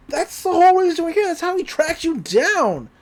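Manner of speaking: high, loud, quick burst; excited boy. Retold or imitated. Boy telling the old man about the journals, excited. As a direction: blurt it out fast and high.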